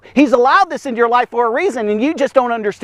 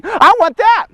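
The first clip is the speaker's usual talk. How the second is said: mock covetous whine; 11 semitones higher, 4 dB louder, slower than usual. Preacher voices envious onlooker.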